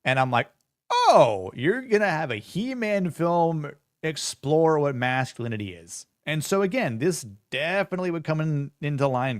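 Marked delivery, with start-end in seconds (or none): 0.9-6.1 sarcastic mock. Mocking the director's claim.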